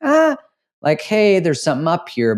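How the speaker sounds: sudden high yelp of alarm, then an urgent hey; the sensitivity sounding an alarm, pitched high; alarmed inner voice. Pretend. Inner sensitivity warning him, high startled alarm. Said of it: "it goes".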